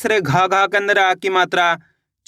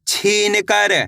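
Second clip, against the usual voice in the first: ordinary talk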